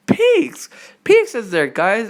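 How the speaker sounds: high, excited fan outburst; excited reveal. Real, not acted. Fan revealing who is in the cliffhanger, excited. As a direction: burst out with the name, pitch leaping.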